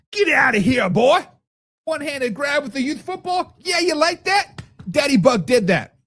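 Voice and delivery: put-on trash-talk your six-year-old after a catch; mock taunting a kid in backyard football; taunting dad. Acting out playing with son.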